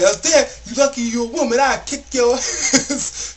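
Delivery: hammed-up threat breaking into laughter; pissed. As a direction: imitate an angry customer, dragging out the last words. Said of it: "he's pissed at this point".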